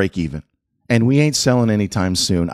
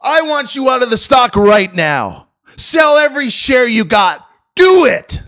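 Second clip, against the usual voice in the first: retold angry exchange; 5 dB louder than usual. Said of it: "I said".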